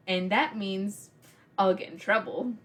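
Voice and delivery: ordinary talk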